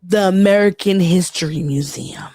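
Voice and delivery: usual speaking voice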